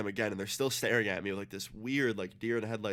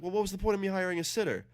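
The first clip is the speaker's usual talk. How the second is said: high, fast imitation of a scolding mother; exasperated mom; 9 semitones higher, faster than usual. Mom learns he left the sitter, exasperated. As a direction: mimic mom demanding an explanation, pitched way up.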